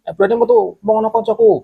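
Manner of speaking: guard taunting the ghost tiger, high and defiant; defiant challenge. Retold or imitated. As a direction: imitate a friend daring a ghost to show itself. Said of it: "bilang gitu kan".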